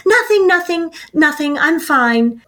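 brushing it off in a high, hurried voice; forced denial. Retold or imitated. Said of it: "And you said".

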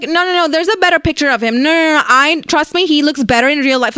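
mock a girl insisting he looks better in person; girls arguing. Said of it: "Like, that's what girls do".

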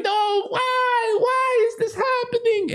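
high-pitched hammed-up wail; crying Maria. Girl on the ground crying at the goat urine, wailing. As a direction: wail it high in mock despair. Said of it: "Maria is on the ground crying".

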